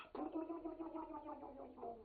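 fast, high, breathy gurgle imitating guts; mock gurgling of innards; rapid gurgling noise. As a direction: rattle off a quick bubbling gurgle. Innards gurgling back to life.

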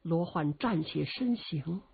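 ordinary delivery, nothing marked

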